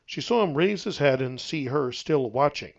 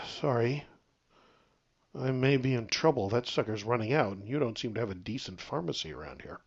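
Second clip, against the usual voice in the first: wheezing; 2 semitones lower, 6 dB quieter than usual. A narrator's reading. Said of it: "whistling undertone".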